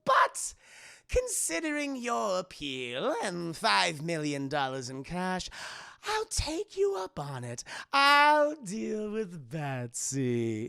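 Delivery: scheming cartoon villain; Joker. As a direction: drag out the last words gleefully. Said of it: "Joker's kinda like".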